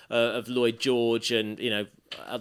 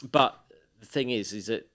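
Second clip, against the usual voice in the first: ordinary delivery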